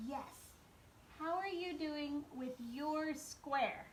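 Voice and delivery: normal talking voice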